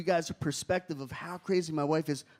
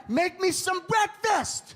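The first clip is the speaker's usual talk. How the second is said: high, slow commanding bark; bossy shout; 9 semitones higher, 3 dB louder, slower than usual. Retold or imitated. Old-time husband ordering his wife to cook, a bossy shout.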